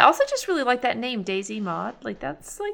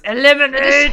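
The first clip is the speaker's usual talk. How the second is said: loud echoed yell; shouting; 3 semitones higher, 12 dB louder than usual. Retold or imitated. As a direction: belt it out like a war cry. Echoing the shouted quote.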